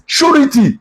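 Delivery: "surety" with an oo vowel and a clear middle ri; correct pronunciation. Shown as a demonstration. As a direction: say "surety" in three syllables, SHOO-ri-tee. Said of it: "pronunciation of that word is".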